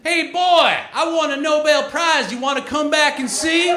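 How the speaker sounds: loud, high-pitched hillbilly holler; cartoon hillbilly. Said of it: "cartoon hillbilly".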